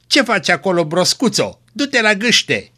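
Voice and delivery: put-on a gruff grandfather calling a dawdling child; stern call across the yard; shouting grandfather. A narrator's reading. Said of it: "îi strigă morarul".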